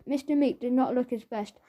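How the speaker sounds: usual speaking voice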